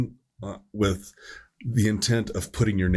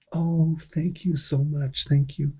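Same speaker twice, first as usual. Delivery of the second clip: put-on higher, slow, gushing old lady's thanks; grateful old lady; 7 semitones higher, slower than usual. Old patient thanking paramedic for church calendar, higher gushing thanks. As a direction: melt into surprised gratitude as the old woman.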